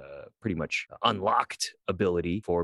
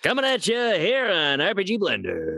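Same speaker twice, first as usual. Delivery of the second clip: high, loud hyped radio DJ; radio host voice; 13 semitones higher, 5 dB louder than usual. Pretend. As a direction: pump it up like a morning-show host. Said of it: "Or no, like a radio host".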